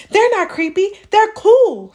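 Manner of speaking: put-on high, loud kid protesting; boy arguing with Mom; insistent child. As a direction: insist like an indignant little boy. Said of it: "said Jasper".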